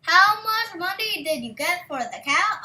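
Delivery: mother's voice. A narrator's reading. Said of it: "asked his mother".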